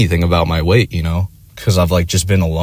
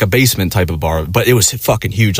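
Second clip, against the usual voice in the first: normal talking voice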